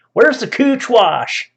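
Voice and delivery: loud, high, drawn-out Southern holler; Southern accent. Acted. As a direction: call out slowly in a hammed-up Southern accent. Replays the loud Southern shopper.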